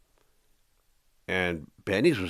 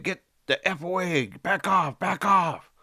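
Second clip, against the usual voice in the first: retold yelling; 9 semitones higher, slower than usual. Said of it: "with a knife saying".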